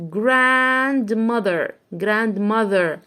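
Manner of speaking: "Grandmother" drawn out syllable by syllable; very slow read. Shown as a demonstration. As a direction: stretch every syllable. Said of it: "reading slowly".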